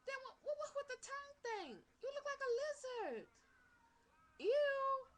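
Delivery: high, loud grossed-out outburst; disgusted. Real, not acted. Reactor to the tongue thing in the video, loudly disgusted. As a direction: recoil in high-pitched disgust, ending in a loud ew. Mocks the performer's tongue move.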